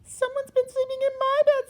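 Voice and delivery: put-on high, loud baby bear squeal; wee little growl. A narrator's reading. Baby Bear finds his bed slept in, high loud wee growl. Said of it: "with a wee little growl".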